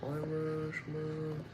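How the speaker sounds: soft, playful put-on Irish accent. Streamer toying with the word Irishman, mock Irish accent. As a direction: repeat it softly in a mock Irish lilt. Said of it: "I did Irish".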